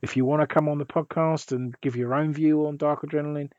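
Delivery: normal talking voice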